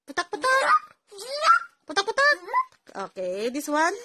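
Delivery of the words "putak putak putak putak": high clucking putak putak; mother showing a toddler the hen sound. Shown as a demonstration. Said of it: "what is the sound ka hen".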